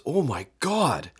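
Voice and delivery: groaning, the taste still burning; real disgust, words breaking off; after bitter medicine. Real, not acted. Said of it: "can't get the taste out of my mouth".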